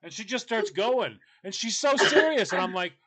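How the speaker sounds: usual speaking voice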